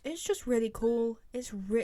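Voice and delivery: ordinary talk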